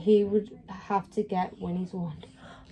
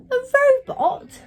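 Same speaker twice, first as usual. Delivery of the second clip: A robot?, high and indignant; a cross witch who can't believe her bear is a robot; 7 dB louder than usual. Acted. Winnie, cross, squeaking up in disbelief. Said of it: "Winnie was cross".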